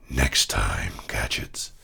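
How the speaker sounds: very low, hushed cartoon villain; deep, growled villain whisper. Shown as a demonstration. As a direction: rasp a menacing threat deep in the throat. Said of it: "What other voices can you do?".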